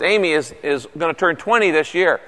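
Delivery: normal talking voice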